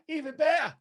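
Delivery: "even better" leapt very high, then falling; "even better" in a high, thrilled pitch jump; excited upswing. Shown as a demonstration. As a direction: squeal "even better" as the idea grows. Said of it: "repeats phrase with lift".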